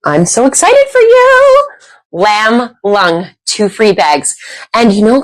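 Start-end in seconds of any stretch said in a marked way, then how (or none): 0.0-1.7 high, gushing congratulations; excited. Real, not acted. Host gushing to a viewer about the prize, high-pitched. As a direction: gush over them.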